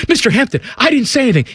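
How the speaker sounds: put-on high-pitched, anxious kid's protest; acted high-voiced protest; pleading protest. Kid protests innocence to his idol.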